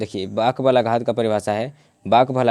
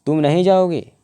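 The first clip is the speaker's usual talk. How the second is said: high, louder example sentence pressing नहीं; stress on नहीं; 7 semitones higher, 4 dB louder than usual. Shown as a demonstration. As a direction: raise the pitch and lean on नहीं. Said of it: "नहीं पर जोर पड़ रहा है". (ऊँचे सुर में "नहीं" पर ज़ोर)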